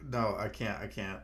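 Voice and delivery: ordinary delivery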